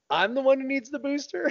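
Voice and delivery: very high, comic indignation; mock-outraged high voice; incredulous complaint. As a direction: squeal it in mock disbelief. Retelling own indignant shock at booster.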